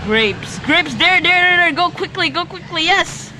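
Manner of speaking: high rushing repeats; urgent excited calls. Real, not acted. Gamer directing his friend in a rush, high urgent calls. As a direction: push him to hurry.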